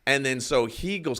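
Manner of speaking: ordinary talk, nothing marked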